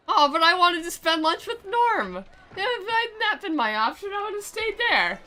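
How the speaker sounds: high, sulky mockery of a lovesick boy; mock whiny hero. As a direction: whine it like a lovesick sap. Mocking the main character's whining.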